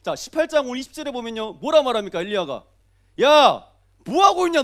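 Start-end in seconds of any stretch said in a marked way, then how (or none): 3.2-4.6 loud, high taunting call; mocking. Elijah mocking the prophets of Baal, taunting shout. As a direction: jeer loudly at rivals. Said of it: "조롱하지 않습니까".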